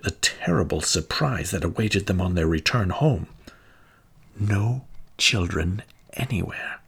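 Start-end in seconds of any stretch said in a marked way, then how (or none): none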